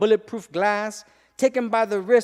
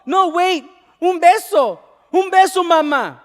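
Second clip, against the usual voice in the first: put-on high, loud child begging for a kiss; cling and beg in a small boy's wail; boy pleading with his mother not to leave; child's screaming plea; 11 semitones higher, 11 dB louder than usual. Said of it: "he screamed and grabbed her wrist".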